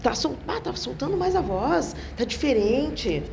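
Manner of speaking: high-pitched, impressed remark; admiring. Acted. Colleague praising a singer's freer voice, admiring.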